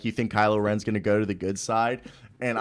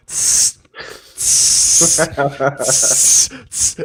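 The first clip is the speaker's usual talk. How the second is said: loud imitated fizz of a slowly opened bottle; hissing seltzer bottle; 3 semitones higher, 12 dB louder, much slower than usual. Retelling the theater story, loud imitated seltzer hiss. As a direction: hiss out the seltzer cap again and again. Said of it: "And I'm just going".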